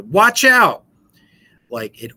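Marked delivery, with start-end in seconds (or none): 0.0-0.8 a sudden, much higher and louder warning yell; exclaiming. Retold or imitated. Parent in the grocery store warning their child, sudden yell. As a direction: snap it out to stop the child in time. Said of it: "exclaiming at your child in the grocery store".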